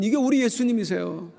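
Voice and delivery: ordinary talk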